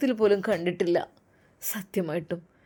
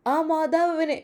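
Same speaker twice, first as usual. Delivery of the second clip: usual speaking voice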